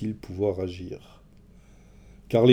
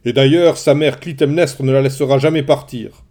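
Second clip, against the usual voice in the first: much louder, higher outraged king; loud shout; 4 semitones higher, 9 dB louder than usual. A narrator's reading. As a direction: bellow it, still refusing. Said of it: "cria Agamemnon".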